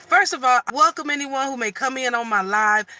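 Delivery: ordinary delivery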